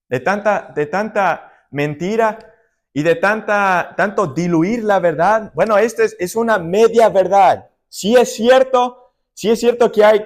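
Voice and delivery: preacher pressing on the eternal consequences of sin; preaching at full force. Acted. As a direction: drive the point home to the congregation.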